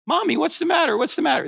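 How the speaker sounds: put-on high, small toddler asking mommy; child's voice, high and worried; little child voice. Toddler voice asking crying mother.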